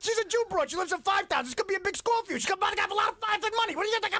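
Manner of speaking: high pushy mobster; Pesci wiseguy voice. Acted. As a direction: wheedle it like a wiseguy. Goodfellas scene acted out.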